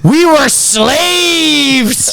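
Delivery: high drawn-out shouted mock hype. [name] grabbing the mic at the party, high drawn shout. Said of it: "He goes".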